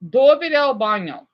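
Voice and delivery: Italian demo; Italian pronunciation. Shown as a demonstration. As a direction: model "Dove è il bagno?" for the class. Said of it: "That's Italian".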